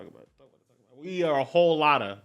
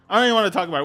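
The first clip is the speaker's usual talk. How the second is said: louder, laughing-off exasperation; louder exasperated repeat; 4 semitones higher, 5 dB louder, much faster than usual. Real, not acted. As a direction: say it again, louder, fed up but amused. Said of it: "repeats it after a laugh".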